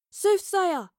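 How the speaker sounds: high, loud call into the distance; yell over storm. A narrator's reading. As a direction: yell to someone ahead through a storm. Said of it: "she yelled over the storm".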